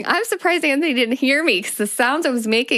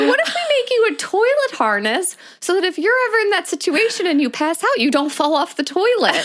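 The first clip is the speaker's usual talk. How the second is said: higher, laughing through husband's idea; laughing while quoting; 8 semitones higher than usual. Retold or imitated. Wife retells husband's toilet harness idea, laughing and higher. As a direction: barely hold it together quoting him. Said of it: "He's like".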